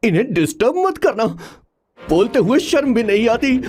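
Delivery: indignant grumble. Acted. Assistant complaining about the order not to disturb, indignant.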